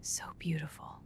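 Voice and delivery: soft awed whisper. Real, not acted. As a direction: breathe it out in wonder. Awed above the clouds.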